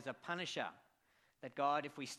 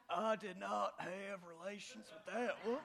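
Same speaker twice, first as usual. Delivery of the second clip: higher put-on Southern presidential voice; Clinton impression; 7 semitones higher than usual. Pastor mimics Clinton's denial for laughs, higher put-on voice. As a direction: deny it smoothly like Clinton. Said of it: "do my best impression of a U S president".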